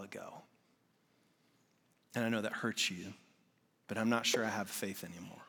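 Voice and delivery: low, soft, heavy retelling; quiet and sorrowful. Retold or imitated. Pastor retells his son losing faith, quiet and sad. Son's painful confession retold.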